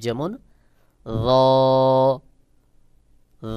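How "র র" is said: র with round sound but unrounded lips. Shown as a demonstration. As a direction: hold a long, hollow র without rounding the mouth. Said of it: "আওয়াজ গোল হবে".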